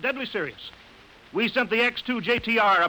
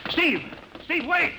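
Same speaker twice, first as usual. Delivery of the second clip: calling out; 10 semitones higher, slower than usual. Acted. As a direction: yell his name across the base, desperate. Calling after fleeing Steve.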